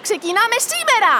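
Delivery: high, rousing announcement; rally the gang with rising energy; excited rallying. Acted. Rallies the group to begin. (ενθουσιώδες ξεσήκωμα)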